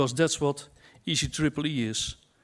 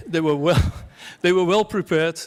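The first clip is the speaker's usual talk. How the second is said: laughing through the words, a little louder; amused, laughing as he backs down from the joke; 2 semitones higher, 5 dB louder than usual. Real, not acted. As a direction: laugh it off while giving praise. Laughs after mock heckling.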